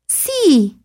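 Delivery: high stubborn doll. A narrator's reading. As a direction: chirp it stubbornly. Doll insists.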